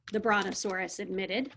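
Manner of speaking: ordinary delivery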